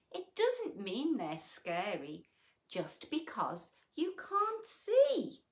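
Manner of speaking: lilting storybook read-aloud; reading to children. A narrator's reading. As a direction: read it like a bedtime picture book. Reading picture book aloud.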